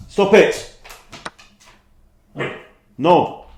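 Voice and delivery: loud, high, firm commands; scolding shout. Real, not acted. Streamer to barking dog mid-game, scolding shout.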